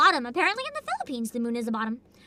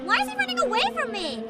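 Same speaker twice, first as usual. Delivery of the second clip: frantic yelling; 9 semitones higher than usual. Real, not acted. Streamer begging a fleeing boss to fight, high and frantic. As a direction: yell after him in desperation. Chasing fleeing game enemy.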